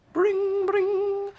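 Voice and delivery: high, loud imitated ring; very high bring bring; phone ring. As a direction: ring like an old telephone. Phone ringing in bag.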